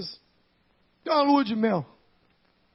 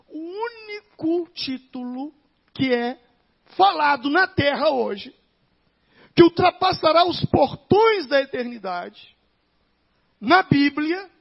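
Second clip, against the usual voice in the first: drawn out and louder, higher; slow loud proclamation; 5 semitones higher, 10 dB louder, slower than usual. Acted. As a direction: proclaim it slowly to the crowd. Preacher rising to proclaim.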